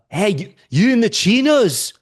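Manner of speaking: high, loud mock call-out; imitated heckler. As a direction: call out like a rowdy stranger. Drunk stranger calls out in story.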